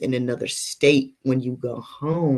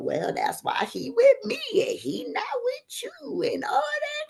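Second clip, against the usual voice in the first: high-pitched sassy retort; mock clapback; 4 dB quieter than usual. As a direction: imitate the girlfriend snapping back in a high voice. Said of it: "clapped back and did all it".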